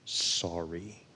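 soft, low, very slow apology; understated sorrow, weighing each word quietly; tearful apology. Retold or imitated. Professor to the student he embarrassed, hushed tearful apology. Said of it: "with tears in his eyes".

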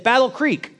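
put-on a knowing old woman's guess; old lady.

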